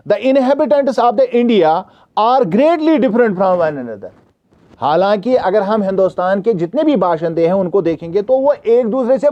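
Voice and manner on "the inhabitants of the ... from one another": slow, careful reading aloud to a class; slow textbook reading. Acted. Teacher reading the question to students, slow and careful. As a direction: read each word out slowly for the students.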